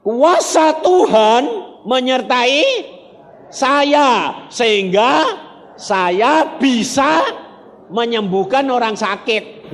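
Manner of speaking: high, loud and slow, stretching each word; fervent preaching cry; proclaiming preacher. Real, not acted. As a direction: proclaim the healing power to the crowd. Preacher proclaims God's power.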